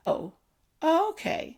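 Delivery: high-pitched, polite "okay"; courteous acceptance. Retold or imitated. Parent answering grown children's news, courteous acceptance. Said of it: "in my common courtesy, I say".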